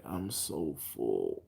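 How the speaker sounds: slow, low, stuffed groan; groaning full. Retold or imitated. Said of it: "was like".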